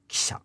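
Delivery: kisha with the i voiceless; devoiced i. Shown as a demonstration. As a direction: whisper the ki of kisha so its pitch vanishes. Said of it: "devoicing occurs on the i".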